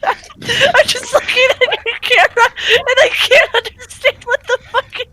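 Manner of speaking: high, laughing disbelief. Real, not acted. Cracking up at a friend's camera, laughing. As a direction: crack up as you ask.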